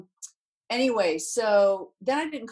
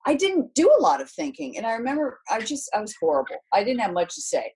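ordinary talk, nothing marked

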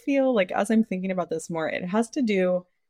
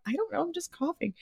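higher, softer, helpless reply; a soft mock-innocent protest to her sister; meek defence; 9 semitones higher, 10 dB quieter, faster than usual. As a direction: shrug it off, small and innocent. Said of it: "I'm like".

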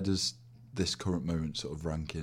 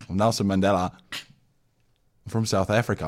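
put-on South African accent; 4 dB louder than usual. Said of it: "do a South African accent".

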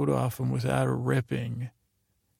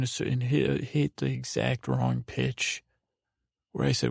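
ordinary talk, nothing marked

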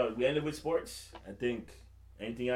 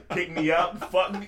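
laughing read; 6 semitones higher, 7 dB louder, slower than usual. Retold or imitated. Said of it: "the man's text message said".

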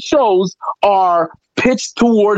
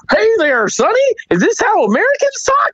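mock Southern accent; 13 semitones higher than usual. Comic pretending to greet Miami locals, mock Southern accent. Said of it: "if I went to Miami and I was like".

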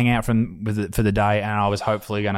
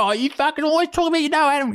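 much higher whiny mock voice; mocking commenter. Mocking a comment about Adam, high whiny voice. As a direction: mimic a nagging commenter, high. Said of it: "someone was like".

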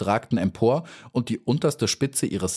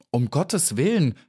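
higher, alarmed exclamation; urgent plea, pitch raised; calling out; 6 semitones higher than usual. A narrator's reading. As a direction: cry out to stop someone, mildly. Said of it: "rief Maya".

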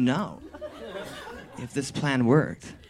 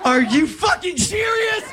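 overjoyed yell. Real, not acted. Teller learns his prank worked and shouts with joy. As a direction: explode with disbelieving joy. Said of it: "I totally lose my shit".

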